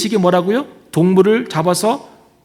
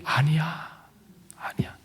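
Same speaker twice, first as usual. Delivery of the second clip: hushed whisper, much softer; 9 dB quieter, slower than usual. Acted. Preacher voicing God's refusal to the congregation, whispered. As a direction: breathe the refusal like a secret.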